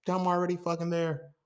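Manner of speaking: high but no longer shouted; restrained insistence. Real, not acted. Podcaster repeating his protest, insistent without shouting. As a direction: insist on it, pulling the volume back.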